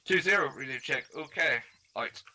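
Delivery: loud radio operator sign-off; radio call. Shown as a demonstration. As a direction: close the net check loud and crisp. Radio check call on net.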